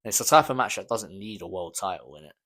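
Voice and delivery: ordinary talk, nothing marked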